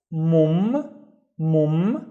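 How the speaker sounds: "mum" sounded out alone with a held M; syllable demonstration. Shown as a demonstration. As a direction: hold the doubled m at the end of "mum". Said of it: "let me split it into syllables".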